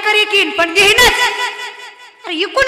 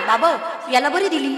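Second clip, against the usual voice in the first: lower, softer gossiping neighbour; mock gossip; 6 semitones lower, 6 dB quieter than usual. Preacher mimics people gossiping about a groom's bride, hushed. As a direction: mutter it like a nosy neighbour. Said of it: "तरी म्हणतात".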